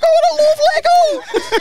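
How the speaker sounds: very high, slow, drunken mock wail; mock emotional wail. Drunk alone in the club as [name] plays, mock wailing. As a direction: wail it high and drawn out, hamming it up. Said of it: "when [name] drops in Pop World".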